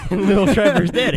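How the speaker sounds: laughing loudly at the line. Real, not acted. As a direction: laugh through the words. Laughing while repeating the line.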